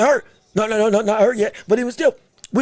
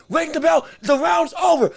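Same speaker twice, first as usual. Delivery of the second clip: ordinary talk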